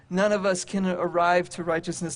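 normal talking voice

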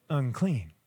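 soft, low, dropped word; not yelling. Retold or imitated. Woman in the crowd keeping quiet, soft low murmur. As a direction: say it almost under the breath. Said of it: "she's not yelling".

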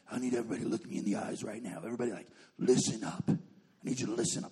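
low, hushed urgent orders; intense guide. Retold or imitated. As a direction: make it low and deadly serious. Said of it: "really intense".

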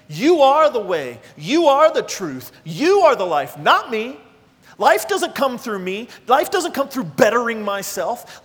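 ringing declaration of faith; preaching at full force. Real, not acted. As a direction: proclaim it like a creed. Voicing Martha's profession, preaching.

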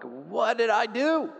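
high, slow bewildered question; baffled. Retold or imitated. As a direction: act innocently confused. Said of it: "and I'm like".

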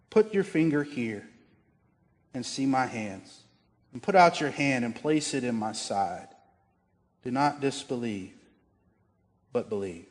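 soft, hushed and unhurried, fading at the end; gently. Acted. Jesus inviting doubting Thomas to touch his wounds, softly and gently. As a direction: offer it gently, like an open hand. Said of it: "he says so gently".

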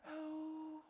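horrified at having to tell everyone; high shocked question; mock dismay. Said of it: "And we're like".

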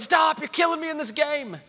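high, acted yell at a brother; gamer kid's yell. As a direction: holler it like a kid losing a video game. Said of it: "he yells".

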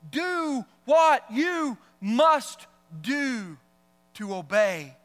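drawn-out solemn command; very slow. Real, not acted. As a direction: lay out each word slowly.

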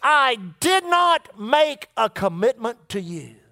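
every word spaced out slowly; a slow, weighty correction; measured, deliberate divine words. Retold or imitated. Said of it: "he said it like this".

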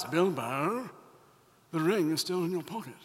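Gandalf impression. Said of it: "I like to do the impression".

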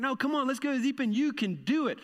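high, quick, cheering dad; excited urging. Retold or imitated. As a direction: pump her up to jump in. Dad urging daughter loudly.

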